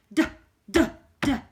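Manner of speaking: loud stomping "Duh" sounds; large dinosaur sound. Shown as a demonstration. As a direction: boom out "Duh, duh, duh" like a huge dinosaur. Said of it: "you make a large sound".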